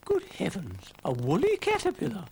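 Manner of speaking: high, loud good heavens; surprised. Acted. Ermyntrude surprised at Brian.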